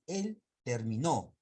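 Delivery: Él terminó said slowly, stress on nó; stress demo. Shown as a demonstration. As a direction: drag out terminó and land on nó. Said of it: "es un verbo".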